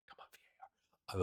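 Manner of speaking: hushed pleading whisper. Real, not acted. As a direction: whisper it into the mic. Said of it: "I'm whispering into the mic".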